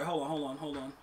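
muttered under breath. Real, not acted. Muttering to self at game.

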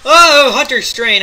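loud high excited shout; excited cry. Real, not acted. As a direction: blurt it out thrilled.

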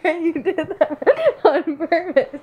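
accusing through giggles; laughing. Real, not acted. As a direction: laugh while you accuse.

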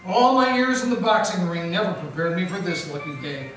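normal talking voice

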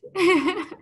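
short delighted laugh. Real, not acted. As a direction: laugh happily. Reacts to puppy request.

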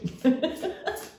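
short high giggle; real giggle. Real, not acted. Reader giggling with delight at a lovely card. Laughs over a nice card.

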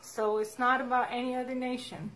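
ordinary talk, nothing marked

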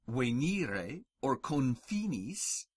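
venire and confinis with long "ee"; venire, confinis with a held ee; long I words. Shown as a demonstration. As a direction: say venire as "way-nee-ray", confinis with "fee". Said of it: "the way we pronounce our long E".

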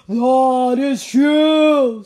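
very loud, slow ogre boom. Acted. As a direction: roar it out slowly. Ogre booming reply.

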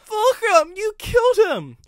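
very high, very loud; Riley screaming at the engine; accusing scream. A narrator's reading. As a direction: shriek the accusation.

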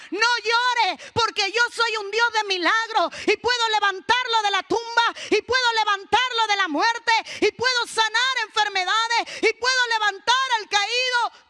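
high, loud declaration in God's voice; full-force preaching. Acted. Preacher speaks as God promising miracles to the weeping, full-force preaching. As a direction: proclaim it with mounting authority.

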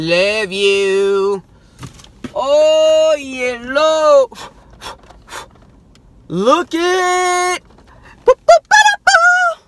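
loud, high yells into sung nonsense syllables; gleeful shouting. Real, not acted. Thanking his Australia friend, shouting and singing gleefully. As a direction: belt your joy, then sing the boops. Thrilled with unboxing.

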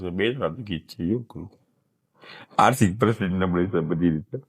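quiet, tearful words, voice dropping away; real crying while talking about hardship. Real, not acted. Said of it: "કેમ રડો છો કાકા?". (રડતાં રડતાં, ધીમા અવાજે)

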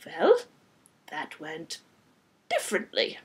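put-on halting French-accented understatement; French-accented vampire, picking words; rich French accent. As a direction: pause between phrases, French accent. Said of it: "with a rich French accent".

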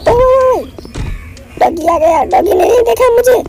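very high startled cry; startled yelp. Real, not acted. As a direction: jump with fright as the dog appears. Dog suddenly appears in game. (चौंककर ऊँची चीख़)

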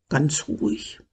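low, soft hush; calm a startled little creature; soothing. A narrator's reading. Wizard soothes a startled dragon.

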